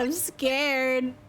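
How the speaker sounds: usual speaking voice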